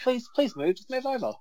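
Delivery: hammed-up polite cyclist plea, too nervous to swear; meek timid request. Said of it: "I would stumble through the swearing".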